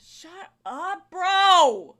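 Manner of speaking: loud playful yell; shouting. Real, not acted. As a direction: yell it at your friend. Yelling at cohost.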